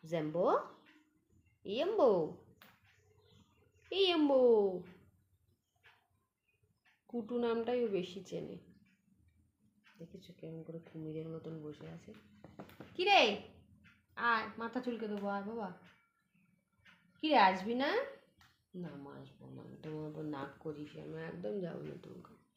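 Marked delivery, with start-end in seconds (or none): 0.1-4.8 playful name call. Real, not acted. Calling the cat's nickname. 12.9-17.4 high, loud pet call; high, bright call to a pet; calling the cat. Real, not acted. 18.8-22.4 voicing the cat. Voicing the cat's reply.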